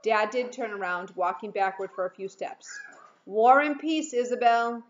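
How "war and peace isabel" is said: loud playful call of War and peace, Isabel. A narrator's reading. Dad calling to his daughter down the block, loud and teasing. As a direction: sing it out loud across the street. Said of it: "he called out".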